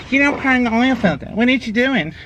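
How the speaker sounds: high, loud mock know-it-all; mocking commenter. Mocking viewers who scold him for priming, high mock voice. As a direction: parody the nagging commenters. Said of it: "I don't wanna hear".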